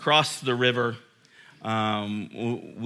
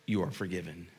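hushed assurance; 6 semitones lower, 9 dB quieter, faster than usual. Real, not acted.

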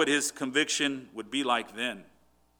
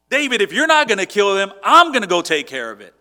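louder, determined declaration; loud threat; 4 semitones higher, 10 dB louder than usual. Retold or imitated. A man telling David he'll kill Saul, loud. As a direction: take matters into your own hands.

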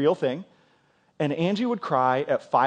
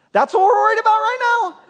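baffled, can't believe this is the worry; incredulous; 7 dB louder than usual. Retold or imitated. Husband to his new-mother wife, squeaky incredulous question. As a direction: pitch leaps way up in loud disbelief.

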